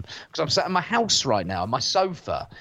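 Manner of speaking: ordinary delivery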